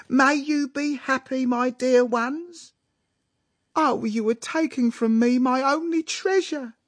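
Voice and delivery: high, loud, gushing mama; crying with happiness. A narrator's reading. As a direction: bless the couple in hammed-up happy tears. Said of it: "crying with happiness".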